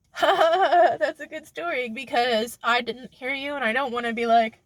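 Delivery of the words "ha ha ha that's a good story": high, put-on appreciative chuckle; fake polite laugh. Covering up not having listened, fake polite amusement. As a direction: feign amusement in a raised pitch.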